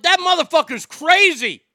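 loud high yell of a shocked moviegoer. Retold or imitated. Moviegoer yells about Norman Bates's shovel kill, loud and high. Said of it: "and yelled".

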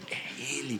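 awed hush; hushed realization. Retold or imitated. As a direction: breathe it out as a revelation. Revelation in prayer.

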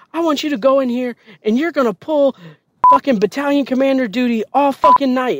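high-pitched, emphatic orders; angry drill sergeant. Retold or imitated. Drill sergeant assigning all-night duty, angry orders. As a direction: lay down the punishment like an angry sergeant. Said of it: "she's angry, like, all the fucking time".

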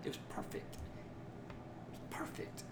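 hushed, slow whisper of perfect. Retold or imitated. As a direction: breathe it out like a sacred secret. Grandmother's spotless house, mocked.

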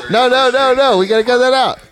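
high, loud yell with laughter in it; half-laughing panicked shout; frantic protest. Real, not acted. Host begging to cut his address from the podcast, frantic yelling.

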